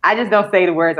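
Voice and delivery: usual speaking voice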